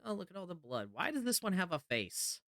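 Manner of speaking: high, alarmed reaction to gore; recoiling at something gruesome; scared horror game streamer. Real, not acted. Sees blood and a face in horror game.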